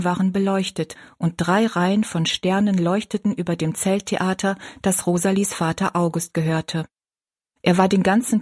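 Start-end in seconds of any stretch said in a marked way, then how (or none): none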